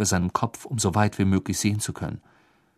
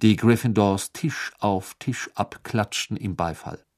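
ordinary delivery

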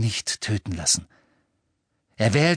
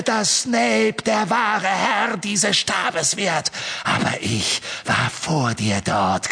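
wild unhinged ranting; 6 semitones higher than usual. Acted. Voldemort's triumphant rant.

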